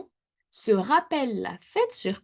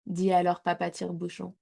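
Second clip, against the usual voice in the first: usual speaking voice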